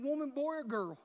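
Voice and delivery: usual speaking voice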